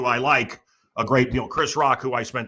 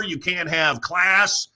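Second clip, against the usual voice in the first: ordinary delivery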